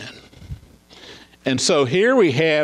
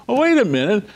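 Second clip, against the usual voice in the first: ordinary talk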